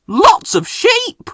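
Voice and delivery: comic reveal. A narrator's reading. Narrator revealing the crowd of sheep, comically high. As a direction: stretch it out in mock surprise.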